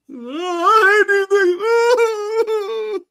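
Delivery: very high, slow, whining mock cry; fake-sob like the beaten fan. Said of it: "He was crying".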